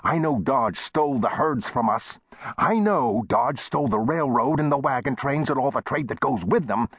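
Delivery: high-pitched indignant tirade; heated. A narrator's reading. Shopkeeper listing the rival town's thefts, heated. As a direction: pile up the grievances in a high voice.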